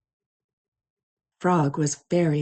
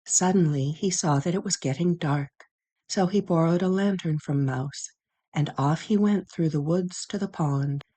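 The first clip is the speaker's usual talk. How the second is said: ordinary delivery, nothing marked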